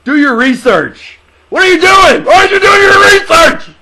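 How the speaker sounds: high, loud mock scolding shout; playful shouted rebuke; mock yelling. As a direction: bark it at him like an outraged coach. Mock scolding co-host over picks.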